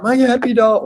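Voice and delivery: high, loud wail of "My yappy dog"; frantic old lady. Retold or imitated. Old lady begs the jogger to save her dog, high wail. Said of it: "She's like".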